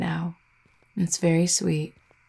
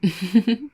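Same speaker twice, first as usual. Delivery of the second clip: brief playful laugh; amused chuckle; 3 semitones higher than usual. Acted. Vampire joking that the sky agrees, amused chuckle. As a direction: chuckle lightly to yourself.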